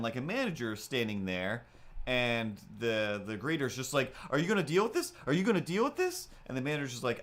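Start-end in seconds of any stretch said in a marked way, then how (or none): 4.3-6.3 high, insistent mock complaint; imitated urgent demand. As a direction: pester the manager in a high, rising voice. Greeter's urgent demand to manager.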